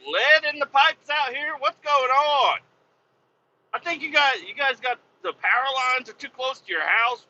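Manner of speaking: high-pitched, incredulous comic outburst; exasperated rant. Acted. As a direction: rant in disbelief at being forgotten. Exasperated rant at forgetful staff.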